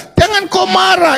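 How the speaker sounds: high, slow, drawn-out taunt; sing-song jeer at the cameraman; mocking taunt. Real, not acted. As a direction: needle him with a stretched high line. Taunting opponent in heated debate.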